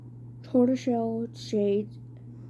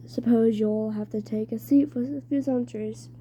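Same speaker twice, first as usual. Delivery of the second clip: put-on strange British-ish accent. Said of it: "He had a strange accent, British, maybe?".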